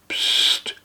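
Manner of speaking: short unvoiced psst; whispered shush. Santa shushing the man on the couch, whispered psst. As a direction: hush him with a breathy psst. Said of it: "sagt der".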